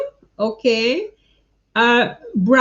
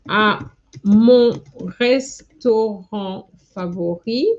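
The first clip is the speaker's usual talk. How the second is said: slow, word by word, with long pauses; drawn-out dictating voice, each word set apart; slow dictation; slower than usual. Shown as a demonstration. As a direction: spell it out slowly as you type it. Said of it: "This is what I was trying to write".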